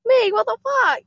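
high, loud stunned cry; floored by the drop; amazed raver. Retold or imitated. Raver answering friends, high loud amazed cry. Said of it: "you're just like".

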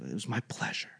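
soft, near-whispered courtesy; low, whispery politeness; hushed server reply. Retold or imitated. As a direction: imitate a polite server very quietly. Imitating pleasant server's soft reply.

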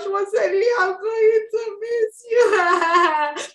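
high, drawn-out crying words; real tearful wail. Real, not acted. Telling her co-host she'll miss her, crying. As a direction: wail it slowly through tears. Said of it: "I'm trying not to cry".

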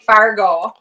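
Upper Midwest "Fargo" with long round O; stretched Minnesota-style "Fargo"; Fargo accent. Shown as a demonstration. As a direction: say "Fargo" like a North Dakotan. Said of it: "gotta say it right. You gotta say".

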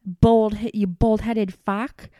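loud imitated insult; retold furious yell. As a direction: bellow the insult like an outraged man. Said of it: "Michael is yelling".

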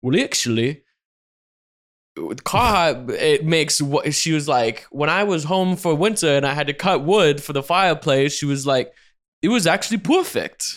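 bad imitation of a Canadian accent; mock Canadian accent, hammed up. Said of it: "Is that your Canadian accent".

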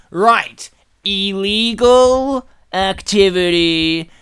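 mock-purposeful, stretched words; deliberate typing commentary. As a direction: spell it out slowly as if typing. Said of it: "he just goes".

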